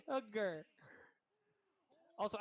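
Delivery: normal talking voice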